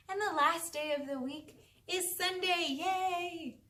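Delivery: high, gleeful cheer; excited cheer. Shown as a demonstration. Cheering the weekend's last day.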